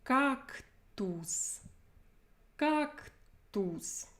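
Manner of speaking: Cactus said slowly and clearly; model word. Shown as a demonstration. Teacher models Cactus for students to repeat. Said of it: "repeat after me".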